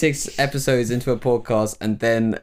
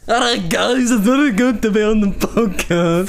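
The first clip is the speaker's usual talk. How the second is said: higher, louder mocking impression of Clark; 9 semitones higher, 5 dB louder than usual. Co-host mocks Clark in a loud, high impression. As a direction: take the mick out of Clark with an overdone cheery greeting. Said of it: "I'll do Clark".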